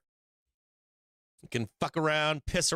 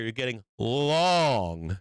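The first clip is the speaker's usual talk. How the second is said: "long" held very long and louder; drawn-out emphasis; 4 semitones lower, 3 dB louder, much slower than usual. Real, not acted. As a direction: stretch "long" right out to hammer it home. Repeats the rule with emphasis.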